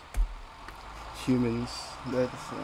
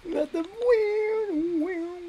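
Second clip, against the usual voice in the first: mock crying; 11 semitones higher, 5 dB quieter, slower than usual.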